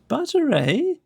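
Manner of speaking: thrilled, bouncing out of bed; delighted. A narrator's reading. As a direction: pitch leaps up with delight. King delighted by butter.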